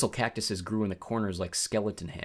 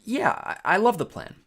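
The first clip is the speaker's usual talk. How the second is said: higher, louder nervous yes; nervous enthusiasm; 10 semitones higher, 6 dB louder than usual. A narrator's reading. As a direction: stumble into eager agreement. Grover feigns enthusiasm, nervous.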